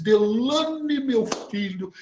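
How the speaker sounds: name swooping high and drawn out; theatrical astonished call. Retold or imitated. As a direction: imitate her dramatic "Delane!". Imitating the woman's dramatic address. (chamado teatral de espanto)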